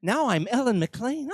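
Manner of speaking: high, put-on woman's voice; playful impression of Ellen McLean. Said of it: "I can pretend that I'm the other actors".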